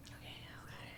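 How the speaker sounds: very soft whisper; barely audible under the breath; hushed self-soothing. Real, not acted. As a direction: whisper it to steady yourself. Steadying self while watching.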